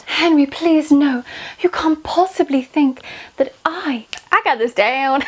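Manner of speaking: high, loud pleading queen; Anne Boleyn. Acted. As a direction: beg Henry desperately, hammed-up. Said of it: "Am I, am I her?".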